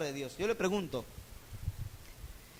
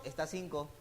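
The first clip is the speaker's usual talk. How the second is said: curt tax collector; 4 semitones lower, 13 dB quieter than usual. Retold or imitated.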